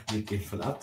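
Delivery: ordinary talk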